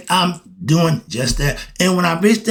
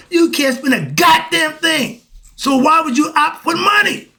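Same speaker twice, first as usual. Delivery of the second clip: ranting shout; 11 semitones higher than usual. Real, not acted. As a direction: preach it at full volume, all fired up. Ranting preacher hits his climax.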